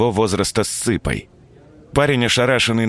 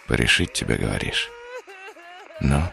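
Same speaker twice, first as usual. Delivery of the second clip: muttered under breath; 6 dB quieter than usual. Acted. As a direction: grumble it quietly to yourself. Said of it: "Тихо пробурчал себе под нос". (тихо под нос)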